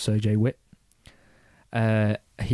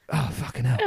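mock tearful groan; half-choked cockney moan, played for laughs; Danny Dyer holding back tears. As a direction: imitate a hard man fighting back crying. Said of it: "starts proper trying to man it out".

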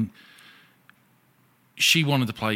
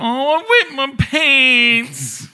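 put-on high, very slow baby voice; mocking the drunk ex in a high babyish whine; baby talk; 8 dB louder, much slower than usual. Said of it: "she talked like a baby".

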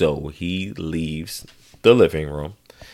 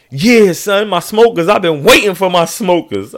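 high, loud insistent dad voice; dad insisting on his smokers; eager dad; 10 semitones higher, 5 dB louder than usual. Retold or imitated.

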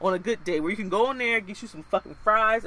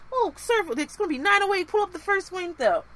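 perky worker; 9 semitones higher than usual. Retold or imitated. Mimics worker giving total.

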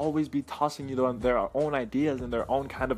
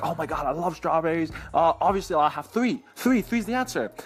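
acting out an eager kid, rising in pitch; excited kid; 4 semitones higher, 4 dB louder than usual. Kid who loves strawberries answers teacher, excited. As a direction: build to a high, louder mock-excited answer. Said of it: "the kid gets all excited".

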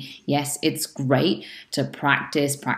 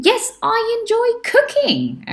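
much higher, bright example answer "Yes, I enjoy cooking"; cheerful model answer; 4 dB louder than usual. Shown as a demonstration. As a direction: lift the voice high to show the good answer. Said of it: "If the question is".

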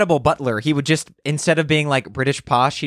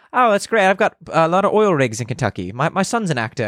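high, chatty mock-British voice; hammed-up British accent, pitched up; British tycoon; 6 semitones higher than usual. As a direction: imitate a rich British stranger. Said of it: "that could have been British Batman".

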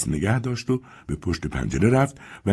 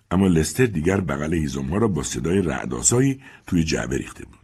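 usual speaking voice

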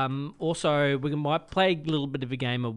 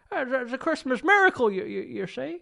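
Jimmy Stewart impression; 8 semitones higher than usual. Host doing a Jimmy Stewart impression his mum hates. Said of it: "whenever I do Jimmy Stewart".

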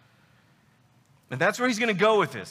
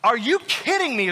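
worked-up, disbelieving question; incredulous Paul; 3 semitones higher than usual. Acted. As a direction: voice the apostle's exasperated disbelief. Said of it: "is what he's saying".

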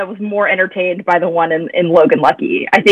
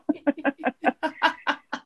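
breathy laugh; 8 semitones higher, 13 dB quieter than usual. Real, not acted. Laughing at the scene.

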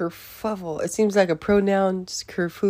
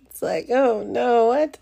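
nervous laugh; 5 semitones higher than usual. Real, not acted. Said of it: "scary stuff makes me laugh".

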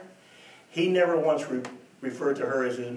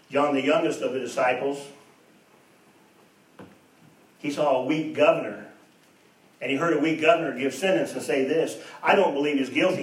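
louder, building sermon cadence; preaching at full force; 3 semitones higher, 6 dB louder than usual. Real, not acted. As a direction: drive the story home to the congregation. Preacher building retelling of trial.

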